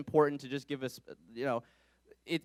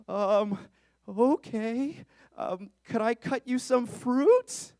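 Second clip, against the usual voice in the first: flustered panic; 8 semitones higher, 4 dB louder, much slower than usual. Retold or imitated. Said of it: "panicked in the moment".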